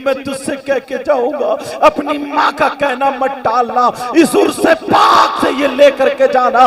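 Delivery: usual speaking voice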